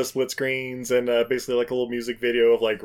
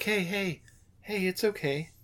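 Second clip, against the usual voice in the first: soft, soothing reassurance; calming; 4 semitones higher, 9 dB quieter than usual. Retold or imitated. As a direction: hush him gently, calm him down. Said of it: "your voice is very calming when you do that".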